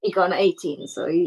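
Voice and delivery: ordinary talk, nothing marked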